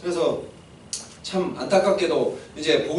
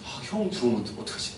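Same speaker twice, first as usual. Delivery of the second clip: low, soft nervous self-talk; anxious undertone; 7 semitones lower, 9 dB quieter than usual. Acted. Jacob watching for his brother, anxious undertone. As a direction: worry quietly to yourself. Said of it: "눈치가 계속 보였을".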